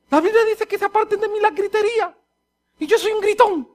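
high-pitched, alarmed discovery; hammed-up consternation; shocked realization. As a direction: realize with dismay that it means you. Acts his shocked realization.

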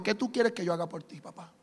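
low-key tender question; casual and tender. Retold or imitated. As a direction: ask him like a friend, gently. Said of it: "Jesús sin pena ni gloria le dijo".

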